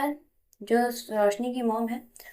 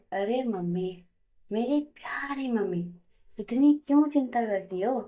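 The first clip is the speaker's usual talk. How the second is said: plain reading voice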